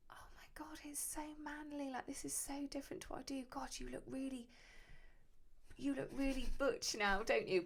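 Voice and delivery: high gushing whisper; lightly mimed gush to a customer; gushing to client. Retold or imitated. Tattooist flattering a client, airy high gush. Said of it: "afterwards I'll be like".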